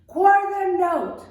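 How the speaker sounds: loud shout of quarter note. Shown as a demonstration. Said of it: "Can you say it louder?".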